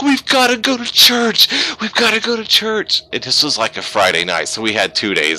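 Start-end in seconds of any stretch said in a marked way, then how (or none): 0.0-3.0 high, loud, overjoyed insistence; excited urging. Retold or imitated. Husband urging wife at night, excited high loud voice. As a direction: blurt it out, bursting with joy. Retold urgent news to wife.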